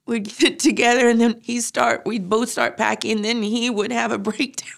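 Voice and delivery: ordinary delivery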